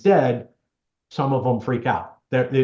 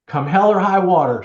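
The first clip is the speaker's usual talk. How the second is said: louder and higher, mock insistence; emphatic quote; 6 semitones higher, 6 dB louder than usual. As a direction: throw it out like the daughter's order. Lady quotes daughter's demand.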